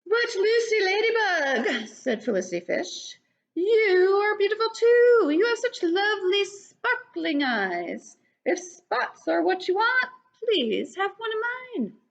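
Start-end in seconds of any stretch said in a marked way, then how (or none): 0.1-1.9 high, giggly kindly voice; laughing fish. Acted. Felicity Fish answering Lucy with a laugh. 3.6-12.0 fish voice. Acted. Felicity Fish offering Lucy a spot, high and friendly.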